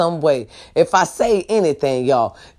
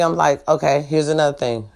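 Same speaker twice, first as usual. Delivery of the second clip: ordinary talk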